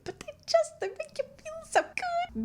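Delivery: squeaky, very high voice; overcome gushing. Real, not acted. Host gushing over a favourite artist, very high squeak.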